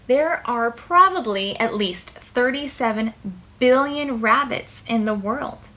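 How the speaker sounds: ordinary delivery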